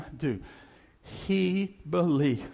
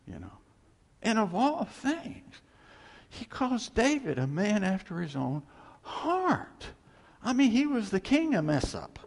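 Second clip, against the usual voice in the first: preaching at full force; 6 semitones higher than usual. Real, not acted.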